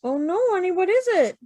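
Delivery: a mock-anxious mother leaning in; worried mom. Mom asks her child what happened, lightly concerned. Improv parent hears child's news.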